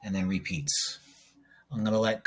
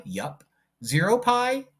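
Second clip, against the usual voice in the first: ordinary delivery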